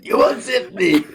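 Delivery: quoting Frank. Retold or imitated.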